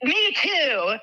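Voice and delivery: put-on high, brash New Yorker accent; a joking New Yorker accent; mock New Yorker accent. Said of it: "my New Yorker accent coming through".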